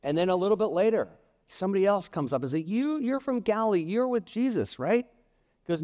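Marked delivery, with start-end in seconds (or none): 2.6-5.1 higher pitched, pointed accusation; lightly voiced accuser pressing him; accusing bystander. Bystander confronting Peter, high and accusing.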